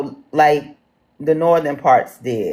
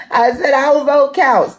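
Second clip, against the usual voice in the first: excited; 9 semitones higher than usual. Real, not acted. Repeating slogan after mute fix.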